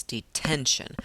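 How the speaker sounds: detention with TEN stressed, said slowly; stress on middle syllable. Shown as a demonstration. Teacher correcting to the right stress, TEN stressed in detention. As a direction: land firmly on the ten of detention. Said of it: "I put that stress on that middle syllable".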